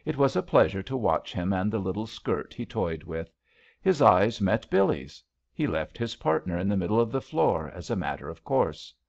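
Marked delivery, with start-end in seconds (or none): none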